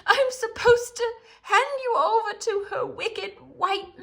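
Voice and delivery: high, loud tearful blurt; blurting faun. A narrator's reading. Faun confessing to Lucy, high tearful blurt. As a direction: blurt it out, half sobbing. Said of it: "blurt out the truth".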